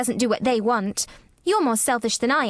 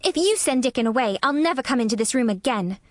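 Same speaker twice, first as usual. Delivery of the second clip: put-on higher, defiant little girl; cross retort; 4 semitones higher than usual. A narrator's reading. Girl in a fine passion threatens sick boy, defiant. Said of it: "she retorted".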